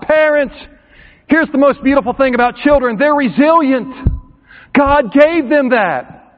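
fiery sermon climax; preaching at full force. Acted. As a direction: build it up and preach hard.

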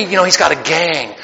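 usual speaking voice

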